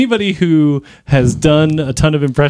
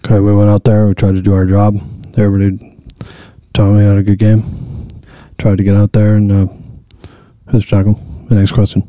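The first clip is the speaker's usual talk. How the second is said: low, loud, flat coach monotone; Bill Belichick impression; 7 semitones lower, 3 dB louder than usual. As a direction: drone through a post-game press answer. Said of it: "Bill Belichick".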